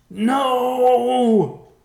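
high, loud, drawn-out scream. A narrator's reading. Riverpaw watches the Guardian fall on his father, screaming. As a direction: scream it long in horror. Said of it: "Riverpaw screamed".

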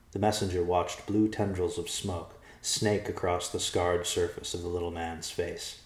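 ordinary delivery

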